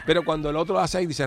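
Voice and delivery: ordinary talk, nothing marked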